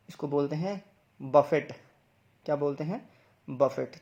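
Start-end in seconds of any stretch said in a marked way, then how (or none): 1.2-1.7 बफेट with a sounded final t, "buh-fet"; common mispronunciation. Shown as a demonstration. Teacher quoting a common mistake, mispronunciation. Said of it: "इसको बहुत लोग गलत pronounce करते हैं". 3.5-4.0 बफेट with a sounded final t, "buh-fet"; common mispronunciation. Shown as a demonstration. Teacher quoting a common mistake, mispronunciation. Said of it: "इसको बहुत लोग गलत प्रोनाउंस करते हैं".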